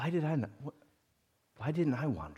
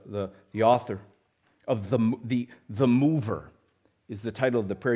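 ordinary talk

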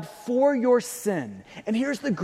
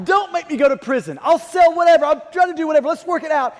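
louder, higher desperate plea; begging debtor; 7 semitones higher, 6 dB louder, faster than usual. Acted. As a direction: beg for mercy from the creditor.

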